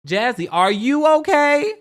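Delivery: high, loud worried call; loud, high mock concern. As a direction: call out to her, alarmed. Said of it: "Missus Gigi says".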